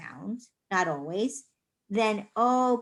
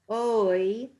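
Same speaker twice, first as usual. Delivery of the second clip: ordinary talk, nothing marked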